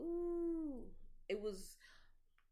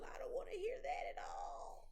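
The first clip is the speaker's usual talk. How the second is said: disgusted whisper, pitch raised; 13 semitones higher than usual. Real, not acted. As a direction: hush it out in disgust.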